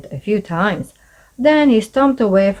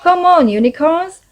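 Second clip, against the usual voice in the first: calling out higher and louder; 9 semitones higher, 4 dB louder than usual. A narrator's reading. Rachel shouting to the rowing team, loud call. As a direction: cheer the team on from the bank. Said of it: "called Rachel in her loudest voice".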